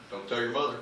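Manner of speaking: understated imitation of Dad's confidential catchphrase; conspiratorial aside. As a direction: drop it quietly, like a shared secret. Dad's repeated secret saying.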